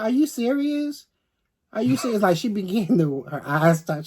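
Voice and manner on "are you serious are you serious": sister can't believe it, pitched way up; shocked disbelief. Retold or imitated. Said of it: "She said".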